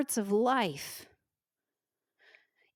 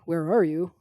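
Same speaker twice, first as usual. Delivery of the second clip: lower, accusing "Where are you?" thrown at God; 7 semitones lower than usual. Shown as a demonstration. Preacher showing the wrong way to ask God, low and accusing. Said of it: "I'm not saying".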